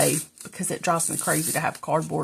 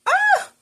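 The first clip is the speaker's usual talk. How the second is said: startled yelp; 7 dB louder than usual. Real, not acted. Sudden cry while crafting.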